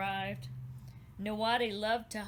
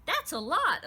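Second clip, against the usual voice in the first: put-on higher, louder little girl's voice; a small girl marvelling, pitched up; lightly voiced little girl; 8 semitones higher, 7 dB louder than usual. A narrator's reading. Little girl Elizabeth to her mother, bright and high.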